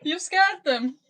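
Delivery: ordinary talk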